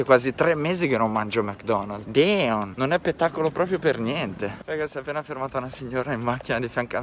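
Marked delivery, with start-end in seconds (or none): none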